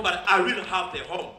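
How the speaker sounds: usual speaking voice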